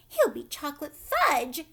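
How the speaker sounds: loud shriek. A narrator's reading. Mother shrieking at Wonka, loud and outraged. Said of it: "shrieked Missus Gloop".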